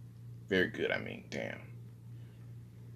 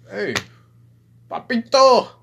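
very high, loud flirty call of Papito; flirty Poppy voice; 10 semitones higher, 10 dB louder than usual. Shown as a demonstration. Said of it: "this is my Poppy voice".

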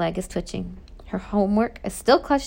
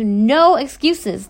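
loud, drawn-out roar, pitched up; 5 semitones higher, 8 dB louder, much slower than usual. A narrator's reading. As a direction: cut them off with a booming shout. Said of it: "the warden roars".

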